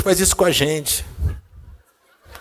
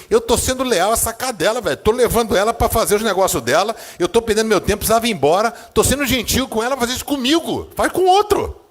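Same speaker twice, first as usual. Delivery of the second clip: a fed-up owner venting, climbing higher; retold angry rant; 7 semitones higher than usual. Preacher telling of losing patience with his dog, building retold anger. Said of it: "Aí eu fiquei bravo".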